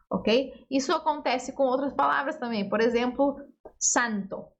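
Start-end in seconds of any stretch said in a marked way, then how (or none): none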